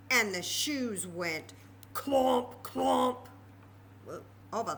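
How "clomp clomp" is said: loud, heavy clomp clomp; clomping sound. A narrator's reading. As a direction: stamp out the shoes' steps. Sound of walking shoes.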